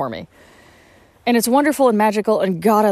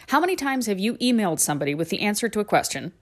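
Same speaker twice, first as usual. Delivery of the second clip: usual speaking voice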